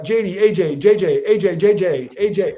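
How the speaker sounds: a flustered father mixing up his sons' names; lightly hammed-up confused dad. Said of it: "He'd be like".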